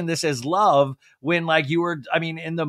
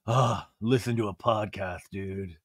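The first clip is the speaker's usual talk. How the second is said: low, deflated mopey dude; sad Sandoval impression; 8 semitones lower, 6 dB quieter than usual. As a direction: sigh it out like a sad guy. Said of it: "like, all sad Sandoval".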